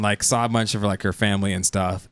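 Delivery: ordinary talk, nothing marked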